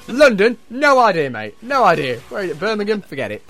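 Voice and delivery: loud mocking judge voice; mock clueless judge. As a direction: shout out the clueless judge's brush-offs.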